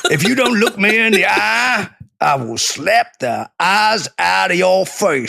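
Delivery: high, blustering Texas threat; mock Texan accent. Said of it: "We're so good at Ameri".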